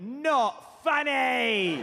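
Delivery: imperious, wailing put-down. Retold or imitated. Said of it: "he went".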